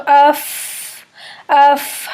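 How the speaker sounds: اف with air audibly escaping the lips; breathy f sound. Shown as a demonstration. Teacher showing the hams of فا to students. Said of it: "منہ سے سانس کی آواز آتی ہے".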